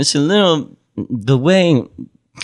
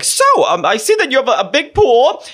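Wendy Williams impression; 12 semitones higher than usual. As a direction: play the nosy host pushing her guest. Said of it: "Wendy's like".

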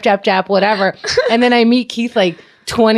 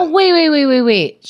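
wait repeated, pitched up high; urgent interruption; 7 semitones higher than usual. Real, not acted. Cohost interrupting the host, high and urgent. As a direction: cut in, high and eager to stop them.

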